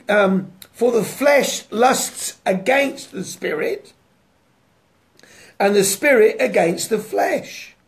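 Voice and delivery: slow, measured Bible reading; scripture reading. Real, not acted. Preacher reading Galatians five to the congregation, measured. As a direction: read the verse with weight.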